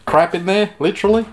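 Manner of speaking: tickled by own crude joke; amused. Real, not acted. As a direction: chuckle into the joke, pitch lifted. Laughs at own crude pun.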